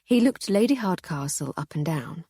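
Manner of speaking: usual speaking voice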